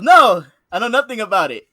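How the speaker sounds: loud, high retold denial; emphatic denial. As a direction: imitate a manager firmly disclaiming it.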